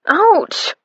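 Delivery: high yelp of pain; a sudden high ouch; sharp exclaim. A narrator's reading. Fanny yelping as her elbow hits the door. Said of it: "Fanny exclaimed".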